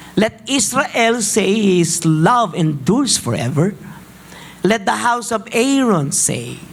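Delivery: slow, solemn reading of the psalm; preacher reading Psalm 118 aloud slowly; scripture reading. Acted. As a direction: read each refrain slowly and deliberately. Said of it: "Allow me to read this for you".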